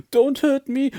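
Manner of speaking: high, loud panicky plea; frightened whimper. Acted. Terrified lion begs the mouse, high whimpering plea. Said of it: "he whimpered".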